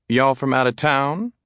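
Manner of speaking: curious Southern neighbour; a touch of Southern drawl asking. A narrator's reading. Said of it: "in a southern drawl".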